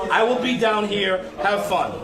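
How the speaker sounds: ordinary talk, nothing marked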